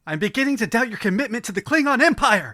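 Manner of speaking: high, loud stern mom impression; Sparkle Motion mom. Podcaster quotes Donnie Darko's stern mom, high and loud. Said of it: "the Sparkle Motion mom from Donnie Darko".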